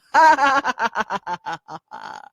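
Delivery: high, mock villain cackle; evil laugh. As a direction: cackle over the secret plan. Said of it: "That's my evil laugh".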